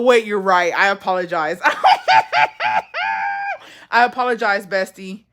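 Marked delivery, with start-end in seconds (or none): 1.6-3.6 high laugh. Real, not acted. Laughing at her own mix-up. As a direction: crack up laughing, pitched way up.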